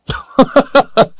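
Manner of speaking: high, loud belly laugh; hearty high-pitched old-timer chuckling; jolly old man's laugh. A narrator's reading. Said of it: "held his stomach as he laughed".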